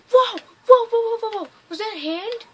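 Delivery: loud, high-pitched alarm; startled. Real, not acted. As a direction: blurt it out in a real startle. Startled by hand in window.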